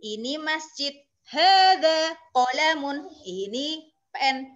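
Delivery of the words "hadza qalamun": hadza maktabun, each vowel drawn out; slow, loud teacher's model of hadza maktabun; slow vowelled reading. Shown as a demonstration. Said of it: "sambil diharokati". (hadza maktabun dibaca pelan dengan harakat)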